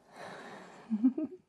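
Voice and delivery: breathy little chuckle. Acted. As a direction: giggle gently under your breath. Alien soothing the patient during exam.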